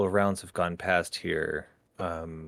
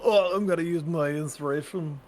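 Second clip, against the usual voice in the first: high-pitched mock player voice; put-on higher voice for a hypothetical player; mock after-fail plea; 7 semitones higher than usual. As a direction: imitate a player pleading late. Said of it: "So you can't say after you fail".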